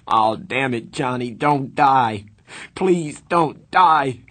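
slow, loud, high cry breaking with grief; anguished pleading. A narrator's reading. Dally begs his dead friend not to die, slow anguished cry. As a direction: drag out each plea in despair.

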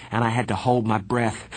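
ordinary delivery, nothing marked

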